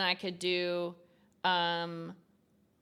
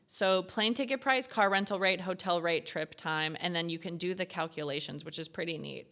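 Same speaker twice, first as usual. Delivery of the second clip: normal talking voice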